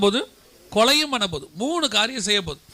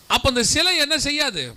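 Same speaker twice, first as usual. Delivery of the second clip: normal talking voice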